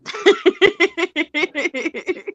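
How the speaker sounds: long, high-pitched laughing fit; high giggling laugh. Real, not acted. Co-host cracking up at own gossip joke, high giggling laugh.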